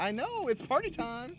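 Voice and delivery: high loud excited squeal; party girl voice. Retold or imitated. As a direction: gush like a wild party girl. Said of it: "the door Delano".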